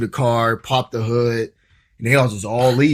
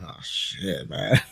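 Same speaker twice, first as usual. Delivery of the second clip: hushed dread; 5 semitones lower, 7 dB quieter, much slower than usual. Retold or imitated. Panic at border barrier.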